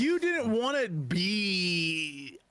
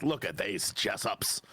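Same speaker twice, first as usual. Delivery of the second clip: gruff dwarf voice; 12 semitones lower than usual. Retold or imitated. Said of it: "he was like".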